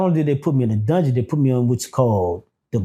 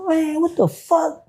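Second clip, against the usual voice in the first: retold alarm; slower than usual. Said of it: "I said".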